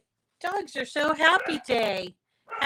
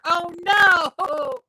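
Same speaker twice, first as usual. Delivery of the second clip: alarmed; 7 semitones higher, 5 dB louder than usual. Real, not acted. Dismay at underwater-sounding audio.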